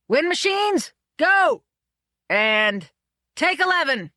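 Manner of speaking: high, loud call for action; a director shouting through a megaphone. A narrator's reading. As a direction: bellow the start of the take.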